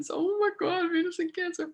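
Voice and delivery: a real catch of tears in the voice; about to cry. Real, not acted. To viewers, holding back tears over her mother, voice welling up. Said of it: "I'm gonna start crying again".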